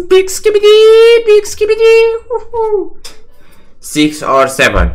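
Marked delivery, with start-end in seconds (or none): 0.1-3.1 very high, loud squealing cheer; real ecstatic win squeal. Real, not acted. Finally wins a hand.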